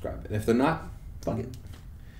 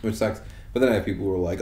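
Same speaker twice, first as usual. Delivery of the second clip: ordinary talk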